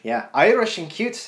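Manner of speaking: a playful, hammed-up Irish accent. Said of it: "this is my Irish accent".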